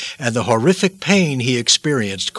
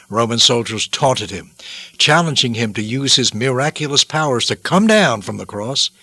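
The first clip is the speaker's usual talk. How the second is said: normal talking voice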